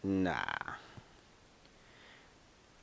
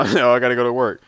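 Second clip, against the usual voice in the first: mock complaint; 4 semitones higher, 11 dB louder, faster than usual. Mocking people's complaints.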